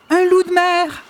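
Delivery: very high excited grandmother; excited exclamation. Acted. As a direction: exclaim with delight. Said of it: "s'exclama grand-mère". (grand-mère excitée)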